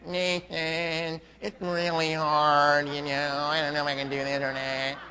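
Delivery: imitating a whiny caller, nasal and soft; mock nasal whine. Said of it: "Don't call me with that nasal voice".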